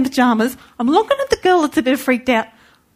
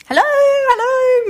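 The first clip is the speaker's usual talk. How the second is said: calling out; 13 semitones higher, 4 dB louder, slower than usual. Retold or imitated. Knocking at the door, calling.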